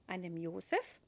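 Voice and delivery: usual speaking voice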